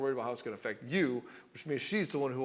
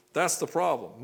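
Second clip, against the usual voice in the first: ordinary delivery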